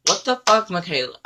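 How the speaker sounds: high, loud outraged yell at a thief; high-pitched retold outburst. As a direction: shout her name in disbelief.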